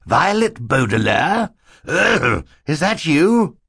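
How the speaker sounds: high, loud questions broken by coughs; astonished, coughing banker. Acted. Mister Poe recognises Violet, astonished and coughing. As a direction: ask in amazement between coughs.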